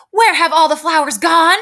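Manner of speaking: high, loud storybook shout. Acted. Teacher reads upset Eugenia's line to students, shouting high. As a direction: shout it with strong feeling. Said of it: "shouted Eugenia".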